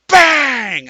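sudden shouted bang, high; loud bang. Retold or imitated. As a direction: slam the word like a door hit. Dog hits garage door.

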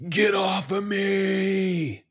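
high, loud, drawn-out reenacted yell; yell at top of lungs. Acting out his buddy being choked by a demonic spirit, full-lunged yell. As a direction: yell it long and high, fighting off an attacker. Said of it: "he yells at the top of his lungs".

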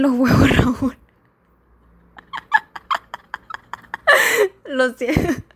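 se le escapa la risa al rematar el chiste; laughing through the punchline and apology; risa incontenible. Real, not acted. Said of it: "me dio risa". (muerta de risa)

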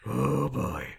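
big exhausted puffs; comically spent, heaving breaths; winded Batman. Acted. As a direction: blow out hard between words. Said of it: "not physically fit enough to do the Batman".

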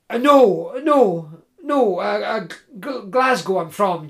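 nervous, halting, tripping on every no; flustered stammer. Acted. Jim nervously denying to the woodsman, stammering. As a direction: fumble for an excuse.

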